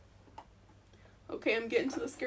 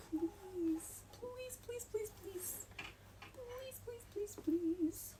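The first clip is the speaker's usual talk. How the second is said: high, soft repeated begging; pleading softly; 8 semitones higher, 10 dB quieter, much slower than usual. Real, not acted. Guiding the seam past the edge, quietly pleading please. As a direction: beg the machine under your breath.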